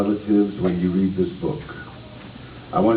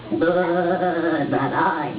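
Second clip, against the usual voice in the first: very slow, higher bleating goat; 6 semitones higher, much slower than usual. Acted. Goat refuses, drawn out.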